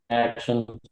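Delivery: normal talking voice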